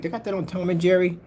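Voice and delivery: ordinary talk, nothing marked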